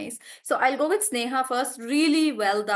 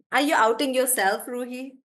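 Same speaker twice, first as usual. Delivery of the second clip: ordinary talk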